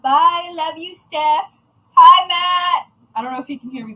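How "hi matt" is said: calling out. Real, not acted. Calling out to Matt.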